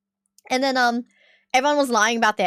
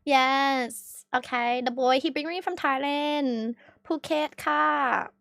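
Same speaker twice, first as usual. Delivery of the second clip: soft, slow mock Thai accent; drawn-out, imitated Thai accent; put-on Thai accent; 5 dB quieter, slower than usual. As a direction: play it up in broken Thai-English. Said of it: "I should have just switched my accent".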